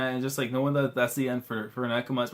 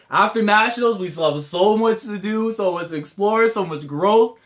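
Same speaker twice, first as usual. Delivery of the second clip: high, loud, emphatic listing; excited hoping; 7 semitones higher, 5 dB louder than usual. Real, not acted. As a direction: build the list with eager, raised pitch. Excitedly lists what lies ahead.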